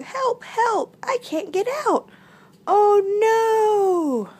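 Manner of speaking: high, panicked little frog voice; frog crying for help. A narrator's reading. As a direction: plead for rescue in a high squeal. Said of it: "Croaked Frog".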